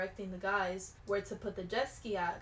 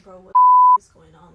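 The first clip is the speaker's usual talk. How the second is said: high, loud freaked-out cry; loud panicked outburst; panicked yell; 27 dB louder than usual. Retold or imitated. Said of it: "I'm over here panicking".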